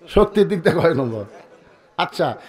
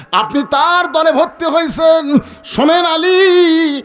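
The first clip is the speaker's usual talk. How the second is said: high, raised confrontation; dramatic high preacher's rebuke; accusing; 11 semitones higher, 6 dB louder, slower than usual. Acted. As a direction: call out the accusation, stretching the name. Acted Umar accuses Ali.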